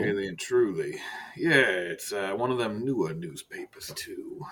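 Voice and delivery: low, soft, measured director voice with long pauses; Director Silas, understated menace. Acted. Director turns to business with Archibald, quiet and measured. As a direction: speak quietly and let each phrase hang.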